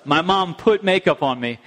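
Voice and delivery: usual speaking voice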